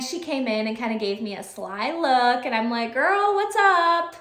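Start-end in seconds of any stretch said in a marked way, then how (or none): 2.9-4.2 put-on high, slow teasing girl what's up; playful greeting. Teacher teasing a student who walks in looking sly. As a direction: draw it out to the sly kid.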